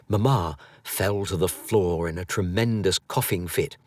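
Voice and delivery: ordinary delivery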